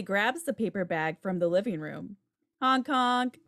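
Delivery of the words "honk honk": voiced car horn, honk honk; playful horn honk; mock car honk. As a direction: imitate a car horn with your voice.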